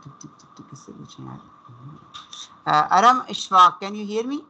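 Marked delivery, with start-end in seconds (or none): 0.0-0.7 soft unvoiced tick tick tick; whispered ticking. Real, not acted. Waiting for a student to answer. 0.7-1.4 whispered aside under his breath; muttering to himself. Real, not acted. Muttering while picking a student. 2.7-3.8 loud, quick call of the name. Real, not acted. Calling a student in online class.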